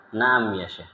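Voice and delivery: meek, nearly voiceless reply; barely audible. Retold or imitated. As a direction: mumble it so it hardly carries. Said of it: "Suaranya nggak kedenger lagi". (jawaban lirih hampir tak terdengar)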